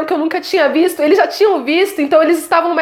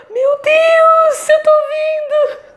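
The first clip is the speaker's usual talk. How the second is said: tearful joyful cry; 12 semitones higher, much slower than usual. Retold or imitated. Reliving first sight of glowing leaves, tearful shout. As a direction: cry out in amazed joy, close to tears. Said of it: "eu fiquei assim, tipo assim".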